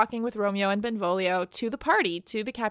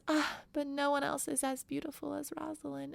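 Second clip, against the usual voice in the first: soft, much quieter mock lament; hammed-up lovesick softness; lovesick sigh; 4 semitones higher, 9 dB quieter than usual. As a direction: sigh it out like a moping lover.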